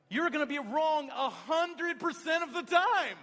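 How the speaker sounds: high, loud, slow proclamation; emphatic shout. Real, not acted. Preacher warns the congregation about perfect days, shouting. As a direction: hammer the point home to the crowd.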